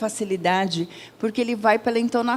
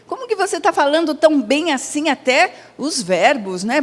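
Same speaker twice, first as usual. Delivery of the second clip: pitched much higher, marvelling at her fluency; amazed question; 6 semitones higher than usual. Retold or imitated. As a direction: ask in genuine astonishment, voice jumping up high. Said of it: "Eu falei".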